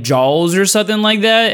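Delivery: ordinary talk, nothing marked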